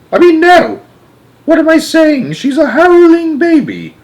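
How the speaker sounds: very high, loud dismay; horrified outburst. A narrator's reading. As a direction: blurt it out aghast, almost squawking. Grandpa corrects himself, horrified.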